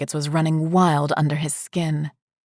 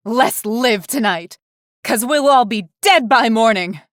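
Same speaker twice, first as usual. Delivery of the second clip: higher and louder, a reckless call to the room; wild karaoke cry; 7 semitones higher, 4 dB louder than usual. Retold or imitated. Into the karaoke mic at the crowd, reckless raised cry. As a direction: fling it out like a dare into the mic.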